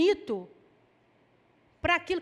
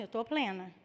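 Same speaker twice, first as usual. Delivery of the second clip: forced serene reply; 6 semitones lower, 5 dB quieter than usual. Retold or imitated. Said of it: "Eu falo assim".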